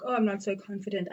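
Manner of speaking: ordinary delivery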